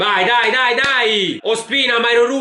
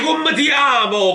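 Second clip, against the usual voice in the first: usual speaking voice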